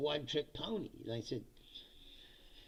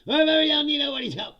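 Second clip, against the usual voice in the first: mock-boastful Trump, higher and louder; mocking Trump quote; 9 semitones higher, 9 dB louder, faster than usual. Mocking the president's boast to his listeners, higher and louder. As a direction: puff it up like a bragging president.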